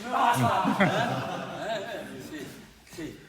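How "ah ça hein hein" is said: bursting out laughing, loud and high. Real, not acted. Instructor laughing aloud at the students' reaction. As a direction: laugh it out big. Laughing outburst during demo.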